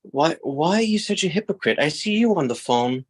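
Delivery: a hint of a child calling her papa out; young daughter scolding. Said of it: "And then Francesca says to me".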